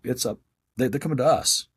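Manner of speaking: normal talking voice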